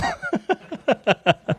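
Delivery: squealing burst of laughter; high shriek of laughter. Real, not acted. Big laugh.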